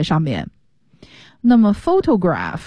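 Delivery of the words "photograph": photograph stressed on the first syllable, PHO-to-graph. Shown as a demonstration. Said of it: "重音在第一个音节fo上面". (photograph 重音放在第一个音节)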